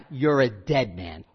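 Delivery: low, soft, slow mobster threat; Godfather mobster. Retold or imitated. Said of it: "It's like".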